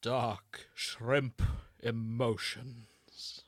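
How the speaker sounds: slow, drawn-out ominous title read; dramatic doom voice. Pretend. Host announcing the fanfic title, slow and ominous. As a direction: stretch each word like a gloomy epic. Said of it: "this needs a voice".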